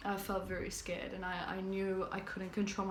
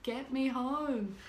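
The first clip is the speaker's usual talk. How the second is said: slower, louder and higher urgent plea; desperate plea; 5 semitones higher, 5 dB louder, much slower than usual. Retold or imitated. Grabbing the friend's top, begging him to take her home, loud and slow. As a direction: beg with the last strength you have. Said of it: "I could barely talk to him".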